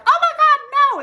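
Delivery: mock panicked shriek. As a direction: squeal it like a panicked elf. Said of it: "the elves are like".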